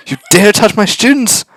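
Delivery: high, loud, slow fury; teacher confronts the villain who hurt her student; outraged teacher. Acted. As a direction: make it a furious challenge.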